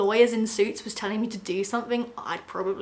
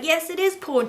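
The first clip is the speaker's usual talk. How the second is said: raised, mock stubborn man; insistent quote; 7 semitones higher, 4 dB louder than usual. Rand insisting to Erica, lightly voiced. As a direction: dig in like a stubborn ex. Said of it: "And he's like".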